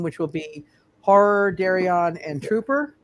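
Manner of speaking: ordinary delivery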